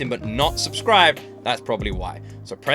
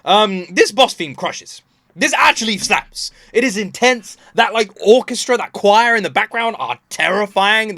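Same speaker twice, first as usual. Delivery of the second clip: higher and louder, hammering the praise; emphatic hype; 5 semitones higher, 5 dB louder than usual. Real, not acted. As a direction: gush about the track with forceful, drawn-out key words. Emphatic praise of the boss theme.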